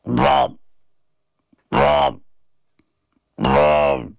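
droning imitated didgeridoo honk; short, buzzing mouth-made drone; strange didgeridoo noise. A narrator's reading. Noise coming out of the boy's gob, droning. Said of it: "a strange noise came from my gob".

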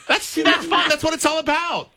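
laughing reaction. Real, not acted. Reacting to caller's juicy story.

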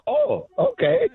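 high slow mock-stunned okay with a laugh; stunned child. Boy hearing scorpion is poisonous, high stunned okay. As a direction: let the shock land, slow and high. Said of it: "And I was like".

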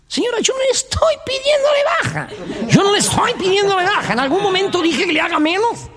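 high-pitched, outraged comic tenant; indignant high protest. Acted. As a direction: squeal your offence at the landlady.

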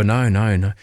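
ordinary delivery

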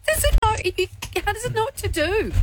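freaked out. Real, not acted. Spooked by the robot.

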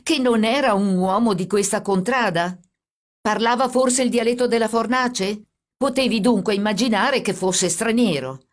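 moderately shouted reproach; shouting. A narrator's reading. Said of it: "gridò il carabiniere".

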